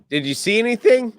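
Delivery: high, quick nervous question; mock Dark Helmet.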